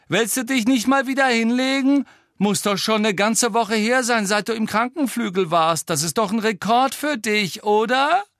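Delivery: high, loud mocking jeer; shouted taunt. A narrator's reading. Malfoy mocks Harry's hospital record, shouted taunt. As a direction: needle them loudly from afar. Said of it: "rief Malfoy".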